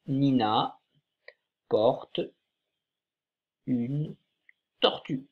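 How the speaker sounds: Nina porte une tortue read word by word with long pauses; teacher reading the sentence aloud slowly, one word at a time. Shown as a demonstration. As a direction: read each word apart for the class. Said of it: "on va la lire ensemble".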